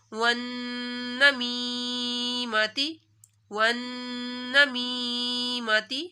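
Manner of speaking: وَالنَّمِیمَةِ with the doubled noon held through the nose, slowly; slow tajweed reading of وَالنَّمِیمَةِ with ghunna; nasal ghunna. Shown as a demonstration. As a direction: hum the noon of وَالنَّمِیمَةِ, then the long ee of یا. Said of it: "نون کے اوپر غنہ ہوگا".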